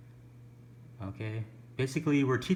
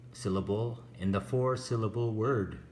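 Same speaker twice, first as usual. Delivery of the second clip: ordinary delivery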